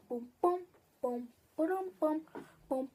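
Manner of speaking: normal talking voice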